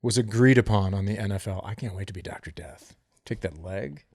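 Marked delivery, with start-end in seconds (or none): 1.6-4.0 low, hushed relish; mock menace. Host dreaming of being Doctor Death, mock menace. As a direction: play the villain under the breath, low and quiet. Savoring an amputation fantasy.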